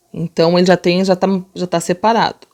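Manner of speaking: ordinary talk, nothing marked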